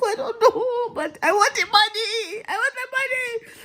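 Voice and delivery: very high, squeaky fake cry; hammed-up tearful whine; mock bawling. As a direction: wail it like a child begging. Mock crying, wants the money.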